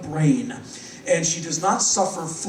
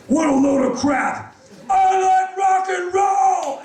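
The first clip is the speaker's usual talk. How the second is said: higher, louder and slow gruff shout; rock shout; 12 semitones higher, 4 dB louder, slower than usual. Retold or imitated. [name] sneering into the mic at the panel, loud slow shout. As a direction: bellow it like a fed-up rock star.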